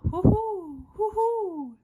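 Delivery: high, loud owl hooting. Acted. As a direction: hoot like an owl. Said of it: "He can sound like an owl".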